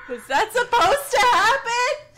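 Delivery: jolted by the scare; shocked gasp. Real, not acted. Gamer caught by a jump scare, shocked gasp.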